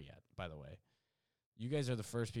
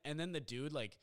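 ordinary delivery

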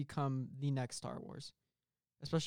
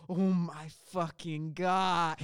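loud, drawn-out horrified exclamation; slow, loud, theatrical dread; mock horror; 3 semitones higher, 7 dB louder, much slower than usual. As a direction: stretch it out in hammed-up shock. Horrified discovery acted out.

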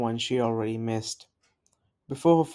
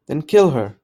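cold command; 2 semitones higher, 10 dB louder than usual. A narrator's reading. Queen orders the killing.

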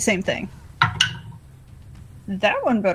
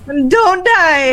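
louder call across the room; calling from far away; 5 semitones higher, 5 dB louder than usual. Acted. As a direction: yell it over to Silas. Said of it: "I'm gonna yell over to".

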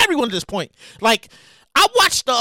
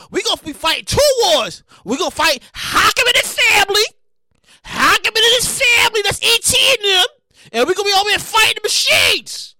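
excited shouting; 5 semitones higher than usual. Real, not acted. Ranting to listeners that the war is coming, shouting excitedly. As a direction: shout the alarm at everyone. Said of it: "Damn, I'm all shouting and stuff".

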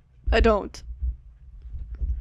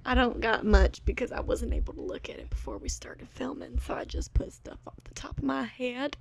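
whispering; 9 dB quieter than usual. Real, not acted. Whispering while filming podcast.

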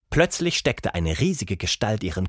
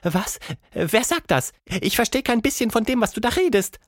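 much higher grumpy ape lady; a cranky ape, higher pitched; 12 semitones higher than usual. Acted. Plappi the ape voiced.

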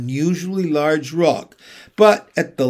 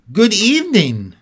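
Lucy voice; 6 semitones higher, 5 dB louder than usual. Acted. Lucy greets the fawn.

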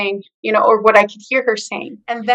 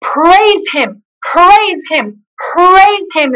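rising, louder call of praise; fervent praise; 7 semitones higher, 3 dB louder, much slower than usual. Retold or imitated. Nurse praising God over the patient, higher and louder each time. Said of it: "is what she said".

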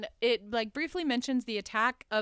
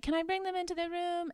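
put-on little girl; 8 semitones higher, 3 dB quieter than usual. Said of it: "Kathy is like".

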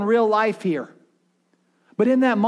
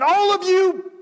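mock furious yell, far higher; angry outburst; 12 semitones higher, 4 dB louder, slower than usual. Moses losing it at the complaining people, mock angry yell. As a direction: explode at the whole crowd in a high shout. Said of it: "And instead of Moses going".

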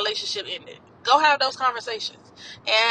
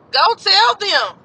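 louder and high, slowed down; emphatic urging; 9 semitones higher, 8 dB louder, much slower than usual. Real, not acted. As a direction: punch it out as a command.

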